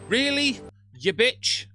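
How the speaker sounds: normal talking voice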